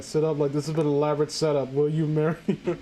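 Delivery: ordinary talk, nothing marked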